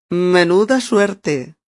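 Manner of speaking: marvelling at a lottery win; admiring exclamation. Shown as a demonstration. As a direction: stretch it out in envy and awe. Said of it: "en sentido positivo y de ponderación".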